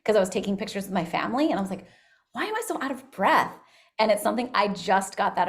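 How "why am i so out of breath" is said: higher-pitched bewildered question; puzzled self-questioning. Retold or imitated. Speaker recalls wondering at herself during family photos, puzzled. Retells puzzling over her own breathlessness.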